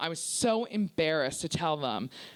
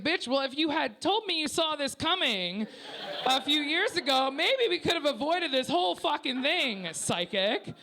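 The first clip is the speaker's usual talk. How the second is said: higher-pitched, indignant comeback; exasperated retort; 7 semitones higher than usual. Retold or imitated. Daughter retorting to her mother's criticism, exasperated. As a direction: fire back in exasperation.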